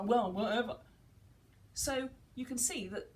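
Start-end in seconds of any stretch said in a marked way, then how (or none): 0.0-0.8 put-on louder, dismissive teen shrug of a line; mock teenage "whatever"; sulky teenager. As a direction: brush it off like a bored teenager. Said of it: "It's a bit like the teenager".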